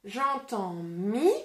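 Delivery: slowly sounding out the syllable mi; syllable sounded out. Shown as a demonstration. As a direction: stretch out mi slowly so children hear it. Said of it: "que j'écris avec un M et un I".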